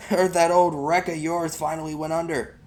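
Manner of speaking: bully gloating about the sunk boat; sneering bully. Acted. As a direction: rub it in with a smirk. Bully taunts the boy.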